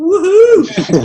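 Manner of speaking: high, loud joyful woohoo; whooping cheer. Retold or imitated. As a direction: whoop it out like you're greeting a stranger with glee.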